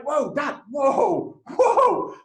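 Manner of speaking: high, startled calls to stop; alarmed whoa. Retold or imitated. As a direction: rein in an out-of-control dad, pitch climbing. Son tries to stop shouting dad.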